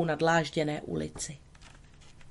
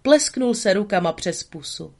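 ordinary delivery, nothing marked

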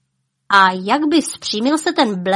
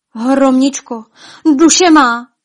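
higher, louder despairing call; desperate call; 5 semitones higher, 9 dB louder, slower than usual. Acted. King calling desperately to his dying wife. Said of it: "volal ji zoufale".

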